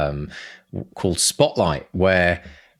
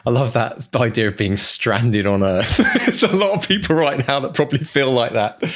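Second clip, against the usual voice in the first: talking through laughter, pitched up; amused, higher voice breaking into laughter; laughing; 5 semitones higher, 3 dB louder than usual. Real, not acted. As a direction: laugh as you say it, lifted and amused. Amused by the book's premise.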